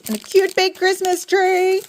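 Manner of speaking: high-pitched delighted gush; excited gushing. Real, not acted. Showing off a Christmas tree cutter, excited.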